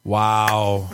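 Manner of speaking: low, long, stretched-out wow; drawn-out amazement. Real, not acted. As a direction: let each wow hang, pitched low. Reacting to a scathing owner reply.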